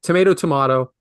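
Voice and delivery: first tomato with "may", second tomato with "mah"; two tomato pronunciations. Shown as a demonstration. Host contrasting versions, tomato "may" then tomato "mah". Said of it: "a way of mispronouncing things".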